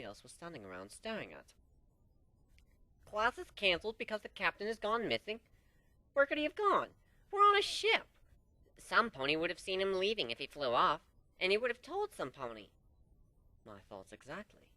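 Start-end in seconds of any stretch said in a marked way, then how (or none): 3.1-12.7 excited reading. Acted. Reading the notice aloud, excited.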